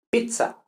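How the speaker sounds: pizza pronounced pitsa, with a crisp t-s; Italian zz. Shown as a demonstration. Teacher shows the right sound, pizza as pitsa. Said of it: "pues pizza es con esta".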